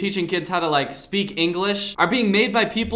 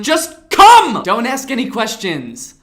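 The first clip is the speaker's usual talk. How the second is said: sudden high loud yell of come; creepy insistent shout; 4 semitones higher, 8 dB louder, slower than usual. Retold or imitated. YouTuber mocking a creepy kids' song: loud insistent shout. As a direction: bark out just come, pushing hard. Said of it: "trying to coerce the kids".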